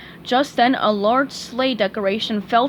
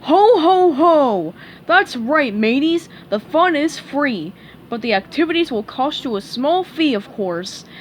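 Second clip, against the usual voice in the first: jolly, louder pirate-crab bluster; Mr Krabs voice; 4 semitones higher, 5 dB louder than usual. Acted. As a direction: boom a hearty ho ho ho. Mister Krabs voiced in story.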